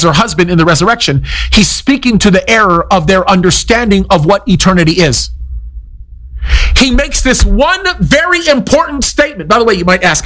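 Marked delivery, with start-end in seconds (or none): none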